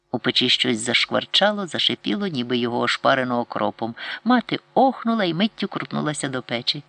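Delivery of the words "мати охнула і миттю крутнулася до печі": ordinary delivery, nothing marked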